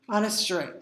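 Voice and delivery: plain reading voice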